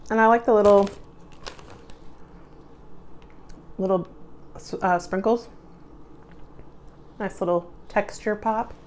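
chewing while talking; talking between bites; mouth full. Real, not acted. Seen 1: Chews throughout; holds cookie, bites it at 9.1–10.7s.

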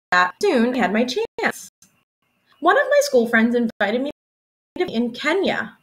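usual speaking voice

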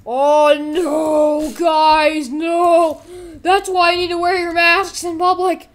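high, very loud drawn-out wail; mock alarm. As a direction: yell a panicked warning to viewers. Mock alarm at infection in game.

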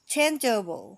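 "changeable" in parts, loud CHAIN then juh-bul; first-syllable stress. Shown as a demonstration. Teacher puts the syllables together, first-syllable stress. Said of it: "เน้นที่พยางค์ที่หนึ่ง".

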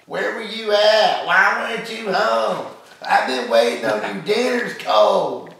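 high, loud, slow mock scolding; angry wife. Husband mocks his angry wife waiting at home, loud high scolding. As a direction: nag him shrilly as the furious wife. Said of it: "this is what you walk into".

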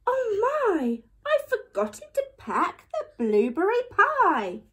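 high, dismayed gasp of remembering; dismayed realisation. A narrator's reading. Mum suddenly remembers forgotten pie.

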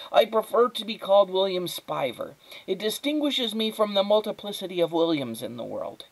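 put-on precocious, pedantic child; prim little boy, pitched high; high, thin boy's voice. A narrator's reading. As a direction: explain your preference precisely in a high voice. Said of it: "His voice was high and thin".

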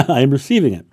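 quick, loud, laughing through it; a real chuckle breaking into the line. Real, not acted. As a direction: laugh off the yawn and say it again. Laughs off her own yawn.

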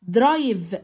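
slow drill. Shown as a demonstration. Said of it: "slow word drill".

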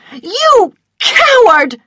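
high, loud, drawn-out shriek; furious scream. A narrator's reading. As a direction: scream the accusation at him. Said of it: "she screamed".

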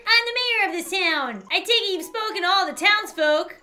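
high-pitched character voice; Kirby the mayor. Acted. Mayor welcoming an adventurer, high character voice.

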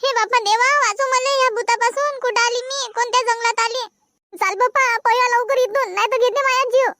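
high, panicked begging to run; terrified pleading. Acted. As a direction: cry for God to save her. Fleeing ghost, pleading. (घाबरून देवाचा धावा)

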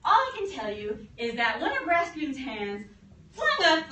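ordinary talk

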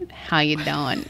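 a hint of a Long Island "How you doing?"; Long Island accent. Shown as a demonstration. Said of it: "we start every episode with saying".

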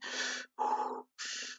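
long, audible breaths in and out; slow preparatory breathing; deep breath in. Real, not acted. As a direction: breathe deeply to steady yourself. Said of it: "let me just breathe in".

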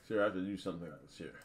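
talking through a mouthful; mouth full. Real, not acted. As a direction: chew while you talk, words muffled. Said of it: "I'm eating with my mouth full".

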